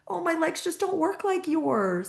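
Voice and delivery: put-on high, slow and gentle, like explaining to a small child; real sweet. Daughter sweetly explaining her legs to a stranger's kid, high and slow. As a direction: sweetly reassure a curious kid. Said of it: "real sweet be like".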